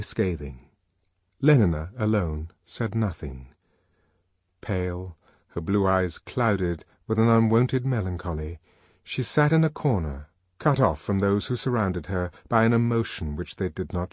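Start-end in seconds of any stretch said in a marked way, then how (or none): none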